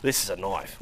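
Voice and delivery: Crocodile Dundee Australian. Retold or imitated.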